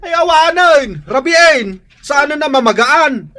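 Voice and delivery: scolding mother. Acted. Mother scolding child about chores.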